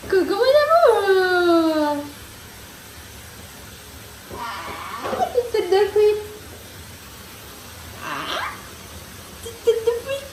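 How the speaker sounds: very high loving coo. Real, not acted. Mother greets her son Liam before his bath, very high cooing.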